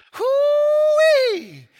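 high, loud hollering call; hog-calling holler. Retold or imitated. Comedian calling his dogs out from under the porch, high loud holler. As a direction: holler it out across the yard like calling hounds. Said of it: "he called for his dogs".

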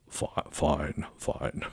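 low, soft presidential murmur; imitated quiet, deep acknowledgement; Nixon. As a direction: say it low and measured, like a president on the line. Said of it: "all the president would say would be".

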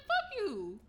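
high-pitched retold retort; mock indignant comeback. Audience member answering panelist, high mock indignation. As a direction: throw it back in mock outrage.

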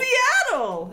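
very high, excited Seattle. Shown as a demonstration. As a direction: shout Seattle with glee. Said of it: "you have to say it with excitement".

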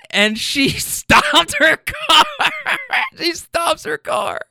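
high, slow, helpless laughter through the words; laughing while talking. Real, not acted. Proud storyteller recalls her stopping the car, cracking up. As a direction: barely get it out for laughing. Cracks up telling the comeback's effect.

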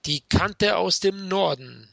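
slow, drawn-out ring announcer call; boxing ring announcer. Pretend. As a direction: stretch the fighter's name like a ring announcer. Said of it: "in der roten Ecke".

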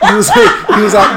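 high laughing shout; shrieking laughter. Real, not acted. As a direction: crack up loudly. Hysterical laughter.

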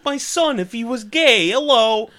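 high, loud caricature; hammed-up impression voice; mock comedian impression. Tracy Morgan character bit.

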